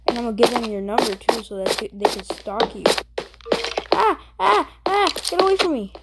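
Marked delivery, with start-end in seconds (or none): 3.9-5.9 high, louder frightened cries; panicked yelps. Real, not acted. Kid yelping as sister comes at him, high and panicked. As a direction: yelp and beg her to back off.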